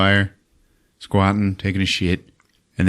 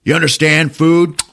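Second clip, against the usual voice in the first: mock emphatic demand; 8 semitones higher, 5 dB louder than usual. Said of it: "And he smacks his lips".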